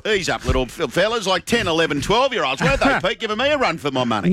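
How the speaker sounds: high, hammed-up commentator call; lightly voiced race-caller excitement; mock Ray Warren commentary. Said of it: "putting on their Ray Warren voice".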